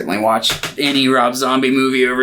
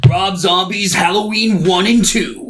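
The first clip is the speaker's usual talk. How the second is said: higher, punchy announcement of the picks; hyped announcer; 7 semitones higher than usual. Acted. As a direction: announce it like a show host.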